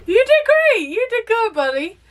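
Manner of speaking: high, bright praise for a buddy; praising like a proud parent. Pretend. Praising Groot on screen, high and proud. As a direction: cheer him on like a proud parent.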